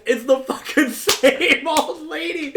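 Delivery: cracking up while telling it; laughing. Real, not acted. Laughing at funny discovery.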